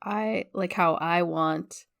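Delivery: normal talking voice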